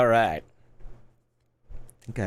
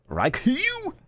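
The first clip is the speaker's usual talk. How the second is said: mock questioning. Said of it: "I'm like".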